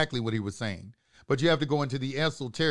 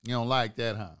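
ordinary talk

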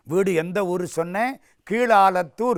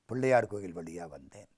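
low, hushed reply under questioning; guilty meek answer; 9 semitones lower, 9 dB quieter than usual. Acted. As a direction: confess it small and soft. Younger brother answers interrogation.

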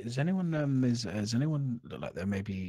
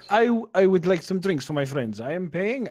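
put-on mild Viking accent; 8 semitones higher, 8 dB louder than usual. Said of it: "accents is tough".

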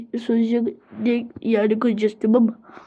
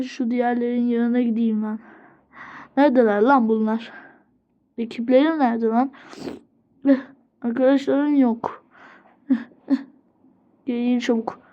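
normal talking voice

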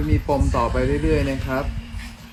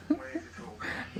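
high airy giggle; 12 dB quieter than usual. Real, not acted. Laugh after the phrase.

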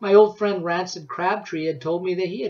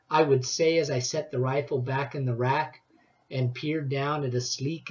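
ordinary delivery

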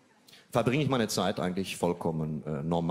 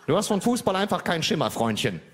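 mock scolding; 9 semitones higher, 4 dB louder than usual. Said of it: "sagte meine Freundin".

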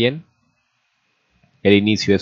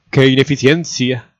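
louder, higher indignant outburst; angry huffing complaint; 4 semitones higher, 5 dB louder than usual. A narrator's reading. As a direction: huff it out as an exasperated great-aunt. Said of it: "La tía abuela bufó de la rabia".

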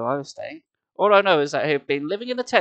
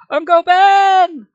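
long, high, loud joyful call; happy cry; 12 dB louder, much slower than usual. Acted. Boy greeting his uncle with a loud happy cry. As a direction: cry out with delight at seeing him. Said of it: "I cried happily".

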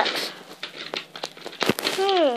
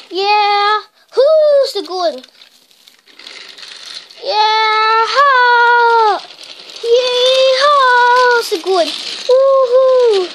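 higher and louder cheering; joyful whooping; 5 semitones higher, 5 dB louder, much slower than usual. Acted. Gordon twisting down the hill, whooping with joy. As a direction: cheer like a cowboy on a fast ride.

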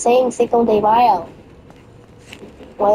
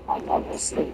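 whisper; 11 dB quieter than usual. Real, not acted. Tired aside.

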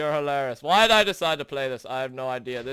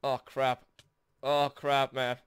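normal talking voice

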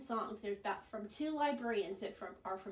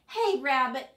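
loud call to a friend; shouting for Rabbit; shouted; 3 semitones higher, 11 dB louder than usual. Acted. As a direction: call out sharply across the field. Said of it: "shouted Bird".

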